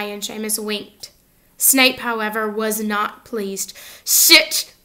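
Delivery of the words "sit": loud sharp order to sit; snapped. A narrator's reading. Teacher snapping at Hermione to sit down, loud and sharp. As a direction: snap it at the girl, loud. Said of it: "he snapped at Hermione".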